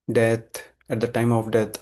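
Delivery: ordinary delivery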